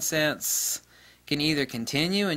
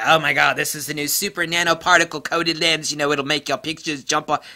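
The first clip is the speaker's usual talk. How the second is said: mock gushing hype; 3 semitones higher, 6 dB louder than usual.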